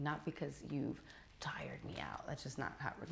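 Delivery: hushed, low clarification; embarrassed correction. Acted. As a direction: drop to a near whisper to set him straight. Embarrassed, correcting him under breath.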